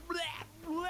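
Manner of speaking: imitated gasping splutter; mock gasping bleh. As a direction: splutter as if coming up for air. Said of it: "gasping for air".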